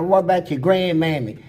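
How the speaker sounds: a mock prison holler. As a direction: holler it across the cells. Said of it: "I used to holler".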